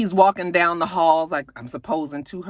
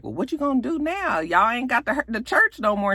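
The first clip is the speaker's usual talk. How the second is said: pitched up, teasing Darius impression; mock Darius; 7 semitones higher than usual. Recapper voices Darius teasing Grace about losing the church, pitched up. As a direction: poke at her with a high teasing voice. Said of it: "and he's like".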